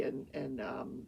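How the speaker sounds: usual speaking voice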